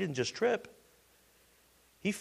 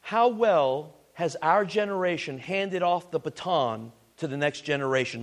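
normal talking voice

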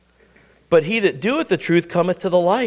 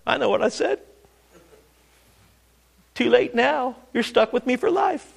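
higher, smug mock politician; 6 semitones higher than usual. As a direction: play the politician who shrugs off broken promises. Politician voiced after breaking promises.

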